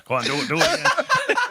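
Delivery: high, loud laughing urge; smiling plea. Retold or imitated. Guard turning back to the comedian with a smile, laughing request. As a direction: coax him to do it again with a grin. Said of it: "back to me with a smile".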